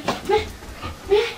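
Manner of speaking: high, soft coaxing call to the dog; high soft come-here to a pet; sing-song dog call. Real, not acted. Calling dog over to show technique.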